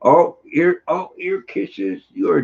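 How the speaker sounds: high, drawn-out coo to a pet; high-pitched pet talk; doting on dog. Real, not acted. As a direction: gush over puppy kisses. Cooing over dog's ear kisses.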